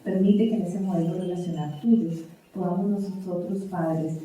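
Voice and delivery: soft, reverent closing prayer. Acted. Pastor praying for parents at close, soft and reverent. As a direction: pray quietly and humbly to the Lord.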